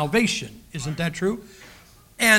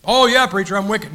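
mock congregant; 8 dB louder than usual. Voicing a congregant's reply.

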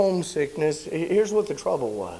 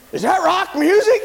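high, loud scandalized roommate; horrified question; 11 semitones higher, 7 dB louder than usual. Retold or imitated. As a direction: ask as if caught in sin.

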